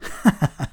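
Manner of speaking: scoffing little laugh; mocking laugh. A narrator's reading. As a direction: laugh at her rival's claim. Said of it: "kissé gúnyosan felkacagott".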